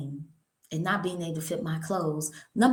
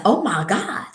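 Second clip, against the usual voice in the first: retold shock; 3 semitones higher, 5 dB louder than usual. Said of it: "I said".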